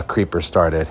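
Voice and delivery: ordinary talk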